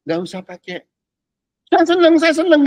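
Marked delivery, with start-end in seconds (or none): 1.7-2.7 high, loud show of gladness; hammed-up "I'm so happy" voice; mock happy exclamation. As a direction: act out gushing happiness, raised and loud. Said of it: "orang tahu hati kamu lagi seneng".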